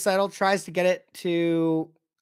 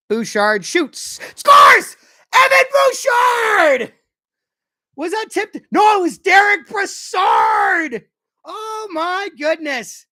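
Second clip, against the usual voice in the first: much higher, much louder ecstatic shout, slowed and stretched; screaming goal call; 13 semitones higher, 10 dB louder, much slower than usual. Real, not acted. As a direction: scream the goal like the whole arena just erupted. Live goal call for his team.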